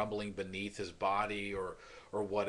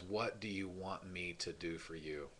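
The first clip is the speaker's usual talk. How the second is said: soft question; 4 semitones lower, 8 dB quieter than usual. Retold or imitated. Jesus gently asks the man.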